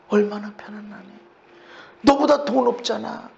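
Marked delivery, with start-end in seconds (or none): 0.1-1.2 whisper. Real, not acted. Pointing at a peaceful face.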